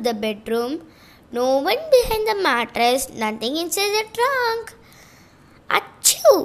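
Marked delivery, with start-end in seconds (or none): 5.8-6.4 high sharp fake sneeze. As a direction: burst out with a sneeze. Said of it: "sneezed the cupboard".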